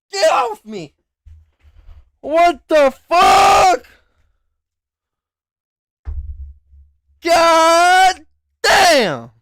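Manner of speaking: loud, high yelling in real pain; pained shout. Real, not acted. Shouting at the cat that bit his lip, real pain. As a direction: yell it out, the cat just bit you.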